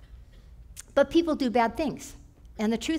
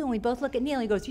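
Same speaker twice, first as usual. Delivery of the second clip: usual speaking voice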